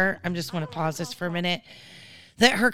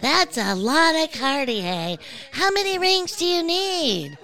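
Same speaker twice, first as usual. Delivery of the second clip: higher, teasing sing-song jab about the rings; mocking catty housewife; 9 semitones higher than usual. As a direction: parrot her snide question back, much higher and mocking. Mimics the housewife's ring jab.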